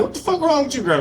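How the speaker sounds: high, startled retold question; mock alarmed friend. Friend finding stoned comic, high startled question. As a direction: pitch it way up in alarm. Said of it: "pulled up on me like".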